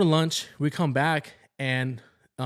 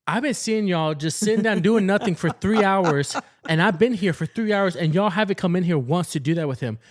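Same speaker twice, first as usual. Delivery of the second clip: mild accusing rant; 5 semitones higher, 5 dB louder than usual. Retold or imitated. Retold accusing sister.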